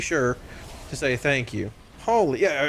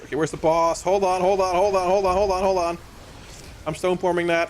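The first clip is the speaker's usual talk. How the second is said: usual speaking voice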